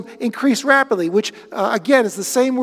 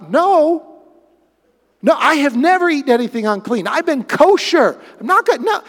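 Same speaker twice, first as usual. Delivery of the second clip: higher, louder indignant protest; Peter's refusal; 5 semitones higher, 4 dB louder than usual. Acted. Peter protesting to God's voice, higher and indignant. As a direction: refuse like an offended man with strict habits. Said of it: "And Peter's response".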